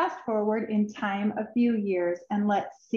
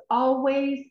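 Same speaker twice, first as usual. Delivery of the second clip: ordinary delivery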